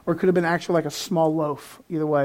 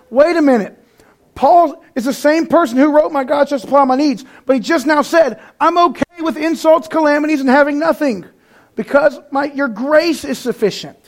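high, louder, building excitement; rising fervor; 7 semitones higher, 5 dB louder than usual. Real, not acted. Preacher marvels at Paul's words, rising excitement. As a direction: preach it with mounting fire.

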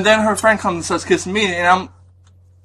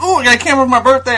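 excited; 8 semitones higher, 4 dB louder, faster than usual. Retold or imitated. Retelling excited reaction.

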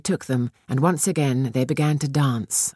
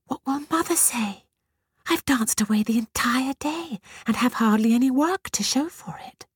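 to herself; 10 semitones higher than usual. A narrator's reading. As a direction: whisper it anxiously to yourself. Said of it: "Batushka said to herself".

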